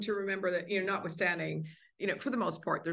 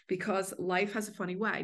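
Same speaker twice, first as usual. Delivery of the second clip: normal talking voice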